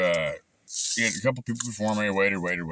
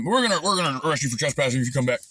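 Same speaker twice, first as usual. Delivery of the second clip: higher, quick imitation of a deputy's warning; mock deputy threat; 6 semitones higher, 3 dB louder, faster than usual. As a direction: rattle off the threat like the cop did.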